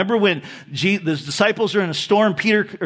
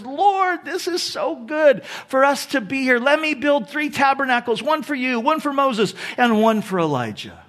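high-pitched eager blurting; bold outburst; 6 semitones higher than usual. Acted. Peter has to say something at the Transfiguration, bold and eager. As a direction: blurt it out boldly, voice raised in pitch. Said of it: "in his boldness, he just has to say something".

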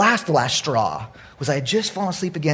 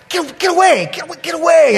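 high, loud, repeated shooing; frantic shooing; 11 semitones higher, 5 dB louder than usual. Retold or imitated. Sleepy owner fending off the dog, frantic. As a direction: push the dog off in comic panic.

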